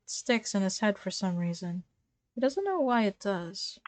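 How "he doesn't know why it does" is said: usual speaking voice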